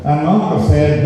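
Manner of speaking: ordinary delivery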